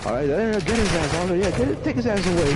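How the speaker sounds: very high, gleeful mock voice; triumphant owner. Car owner calling police to the thief, high glee. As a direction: hand him over, gloating. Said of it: "the police were".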